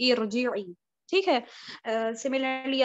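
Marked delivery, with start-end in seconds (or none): 0.1-0.7 इरजिई begun with a short "i"; kasra start. Shown as a demonstration. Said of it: "मैंने इसके start में भी कसरा डाल दिया".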